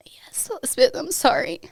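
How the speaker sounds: fighting tears while quoting the news; tearful. Real, not acted. Wife retelling her brother's confirmation, real tearful voice.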